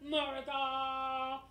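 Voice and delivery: high, drawn-out mock war cry; battle cry. Capitol stormers charge on the battlefield, drawn-out mock battle cry. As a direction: bellow it long like a charging patriot. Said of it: "on the battlefield with their horns".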